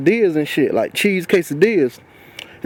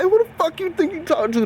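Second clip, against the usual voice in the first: high, raised voice at the boss; angry retold yell; 11 semitones higher than usual. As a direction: yell it back at him, offended. Confronting him again after backpack.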